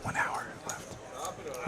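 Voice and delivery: whispered aside, barely voiced; hushed poker commentary. Real, not acted. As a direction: breathe it out in a whisper. Quiet time reminder on stream.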